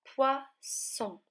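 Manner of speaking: "poisson" in two separate beats, falling at the end; syllable by syllable. Shown as a demonstration. Teacher shows children the rhyme, "poisson" split syllable by syllable. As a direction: pause between poi and son in "poisson". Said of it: "Ça rime en son".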